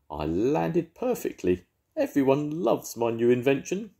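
a dog convinced he triumphed; dazed proud Rhubarb. Acted.

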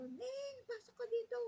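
high urgent call. Retold or imitated. Frightened aunt calling the niece into the bathroom, high urgent call. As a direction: shout her in, high and urgent.

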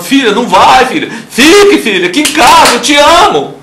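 a desperate mother crying out to her daughter; pleading mother. Retold or imitated. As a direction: beg the daughter to stay home. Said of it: "A mãe tá lá chorando".

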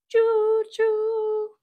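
high loud choo choo whistle; train whistle cheer. Acted. Papa train cheering his son with a loud whistle. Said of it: "Papa Pufferbelly cheered".

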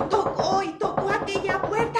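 loud, high, urgent storytelling; dramatic narration. A narrator's reading. Storyteller telling of Mary pounding on the door, dramatic and urgent. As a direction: build the frantic knocking with volume.

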